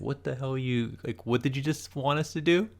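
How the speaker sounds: high-pitched, incredulous questioning; bewildered, voice climbing at the end; baffled disbelief. Pretend. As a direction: ask as if utterly lost. Imagined baffled reaction to Mince's speech.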